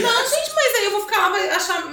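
high, laughing protest. Real, not acted. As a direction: protest while cracking up. Laughing protest about her fear.

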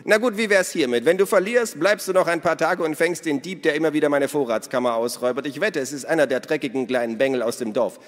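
hoch und laut, listig-verschwörerisch; verschmitzte Minna. A narrator's reading. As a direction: die Diebin prahlt augenzwinkernd. Narrator voices Minna. (hohe, laute, listige Frauenstimme)

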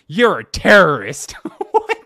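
very high, loud, laughing; laughing shout. Real, not acted. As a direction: lose it at the punchline. Laughing through punchline.

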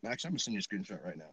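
ordinary talk, nothing marked

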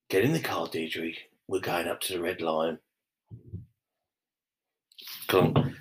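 low, soft command; mock tough low order; low gruff order. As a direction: order her into the car in a low voice. Low voice to Deirdre.

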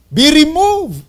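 Be removed said loud and clear; emphatic command. Shown as a demonstration. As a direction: declare it with force. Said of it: "Clear. Emphatic".

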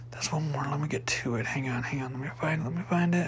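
quiet mutter while searching; muttering to himself. Real, not acted. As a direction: mumble under your breath, hunting.

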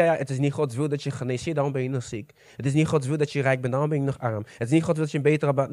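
low, soft voice of the liar; Satan. Acted. Satan lies to a believer about God's will, low and soft. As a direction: drop down into quiet, insinuating lies. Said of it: "Satan heeft heel vaak kunnen liegen tegen je".

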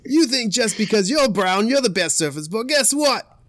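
loud, high Aussie trash talk; Australian accent. Acted. Australian surfer announcing himself to Hawaiians, loud Australian accent. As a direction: taunt them loudly in a broad Australian accent. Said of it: "How would an Australian sound talking shit".

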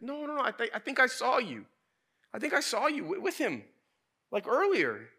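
high-pitched mock girl's voice; servant girl. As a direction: imitate an insistent girl, loud and way up high. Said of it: "And then the servant girl".

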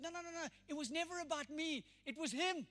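loud and high, HIM hit in every line; rising preached testimony. Acted. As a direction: build each line like a climax.

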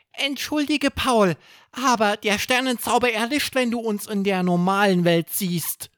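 hohe, fremde Tierstimme, entschuldigend; erklär es sanft mit hoher Wesensstimme; Fabelwesenstimme. Acted. Zauberwesen erklärt Paul. (entschuldigende, hohe Stimme eines Zauberwesens)

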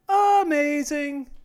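high, loud, slow amazement; excited exclaim. Real, not acted. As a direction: stretch it out, thrilled. Exclaiming after a hit.

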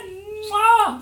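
big high hammed-up mwah; loud smacking kiss. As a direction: plant a theatrical kiss. Kiss sound in reunion.

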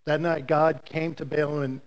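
usual speaking voice

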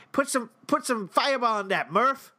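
high, fast, hammed-up Boston accent. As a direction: call the bartender Murph like a Boston local. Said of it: "our best Boston accented".

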